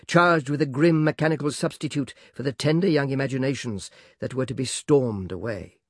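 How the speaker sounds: ordinary delivery, nothing marked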